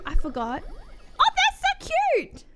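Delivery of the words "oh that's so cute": high squeal of delight; 可愛いものを見て、高い声で歓声を上げる; cute squeal. Real, not acted.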